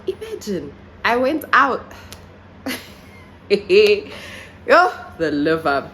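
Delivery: normal talking voice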